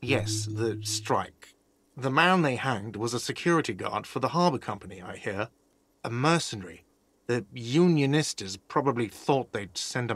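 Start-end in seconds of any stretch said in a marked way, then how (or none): none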